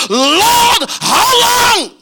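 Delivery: high, pleading wail; lifted lament; anguished cry. Acted. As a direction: cry it out to God. Congregation's cry to God.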